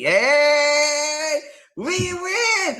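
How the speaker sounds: high, bright victory cheer; teary, excited yay. Real, not acted. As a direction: celebrate like you just won. Celebrating victory.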